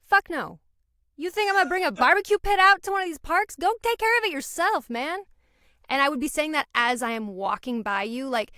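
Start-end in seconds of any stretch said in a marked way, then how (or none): 0.0-5.2 loud, high, fast refusal; brash New York no. Shown as a demonstration. New Yorker refusing while walking by, brash and loud. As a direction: throw the request straight back, loudly. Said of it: "Do you wanna know the New York no?".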